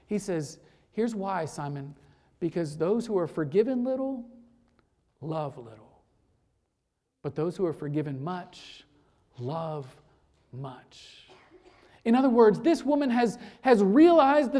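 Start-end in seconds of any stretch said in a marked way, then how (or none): 0.9-11.3 a preacher hushing the key line for weight; hushed. Acted. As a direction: let each phrase fall away quietly. Climax line delivered hushed.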